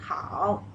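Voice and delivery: "Hao" with a dipping third tone. Shown as a demonstration. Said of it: "it is the third tone".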